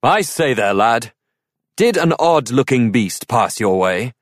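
a knight calling out across the forest. A narrator's reading. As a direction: hail a stranger from a distance. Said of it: "called to him".

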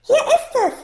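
high, loud, proud announcement; triumphant child's cry; proud call. A narrator's reading. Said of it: "rief Safir ganz stolz".

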